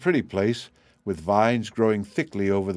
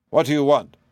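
louder, lightly gruff demand; stern, gruff; 4 semitones higher, 5 dB louder than usual. A narrator's reading. Unseen man challenging strangers in his house, stern and gruff. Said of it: "in a stern, gruff accent".

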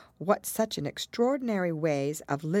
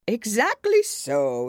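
loud, drawn-out Hatter; conceding Hatter; 7 semitones higher, 8 dB louder, slower than usual. A narrator's reading. Hatter answering Alice's objection, conceding. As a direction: concede it loudly and slowly.

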